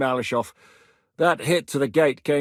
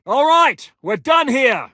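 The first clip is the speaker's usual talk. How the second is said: much higher and louder call; 11 semitones higher, 7 dB louder, slower than usual. Acted. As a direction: shout it to the whole group. Calling out after the job.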